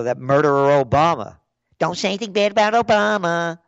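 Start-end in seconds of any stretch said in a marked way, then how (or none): 1.8-3.6 high, fast mocking whine of protest; mocking put-on liberal voice; phony liberal. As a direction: mimic a scandalized liberal scolding you. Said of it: "All these phony liberals out there".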